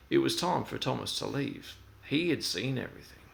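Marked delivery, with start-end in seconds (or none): none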